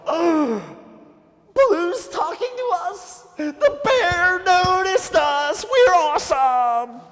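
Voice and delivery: high-pitched, drawn-out squeal of delight; gasp, then squeal like starstruck monkeys; thrilled monkeys. Acted. Monkeys thrilled that the bear noticed them, excited squeal.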